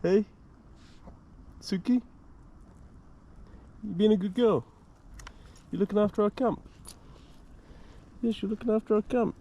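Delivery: high sing-song voice for a dog; dog-talk voice. Real, not acted. Talking to the dog.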